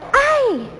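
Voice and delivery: high sudden ouch; cry of pain. Shown as a demonstration. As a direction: yelp as if you just got hurt. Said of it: "solo gritas".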